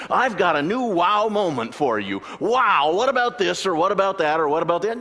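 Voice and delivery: a hammed-up thrill-seeker crying wow; mock excited shouting. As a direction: hype it up like a breathless fan. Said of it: "and screams".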